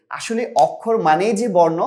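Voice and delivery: normal talking voice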